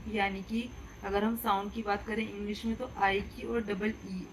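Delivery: usual speaking voice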